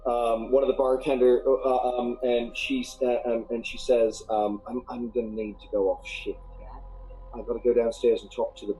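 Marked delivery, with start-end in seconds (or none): 4.7-8.7 quiet, lower barmaid. Acted. Barmaid quietly asks to go off shift.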